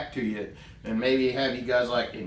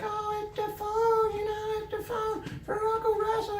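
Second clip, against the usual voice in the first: excited Steuben. Retold or imitated. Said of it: "he gets all excited".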